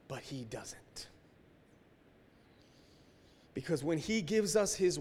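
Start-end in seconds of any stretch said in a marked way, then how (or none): 0.1-1.1 slow whisper for emphasis. Real, not acted. Preacher to congregation, slow hushed whisper. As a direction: drop to a slow hush. Sermon point made hushed.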